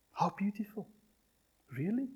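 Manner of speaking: whispered question. Acted. Preacher quietly asking the congregation, whispered. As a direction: whisper it intimately.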